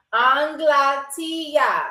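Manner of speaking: Anglatia stretched slowly; slow emphasis. Shown as a demonstration. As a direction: drag Anglatia out long. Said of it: "asking the bear".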